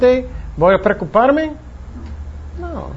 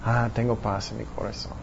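very soft, low sigh of peace; peaceful relief; 7 semitones lower, 13 dB quieter than usual. Acted. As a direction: breathe out contentedly, hushed and low. Voices relief after late-night prayer. (suspiro de paz, muy bajo y suave)

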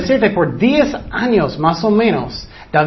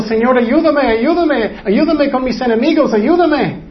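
high, repeated cries for help; crying plea; 7 semitones higher than usual. Acted. Preacher acts out David begging God against enemies, tearful pleading. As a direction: beg urgently, acting tearful without real sobs. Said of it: "él estaba llorando".

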